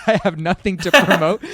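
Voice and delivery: laughing through the words. Real, not acted. Laughing at his own lack of promotion.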